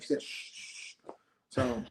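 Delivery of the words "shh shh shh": very soft, voiceless shushing; imitated hushing. Contestant retelling how friend silenced talk of their plan, imitated soft shushing. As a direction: hush someone in three quick shushes.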